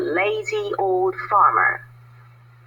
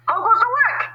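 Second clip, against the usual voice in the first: farmer calling. Acted. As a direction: bellow it as the lazy farmer. Farmer calls from bed.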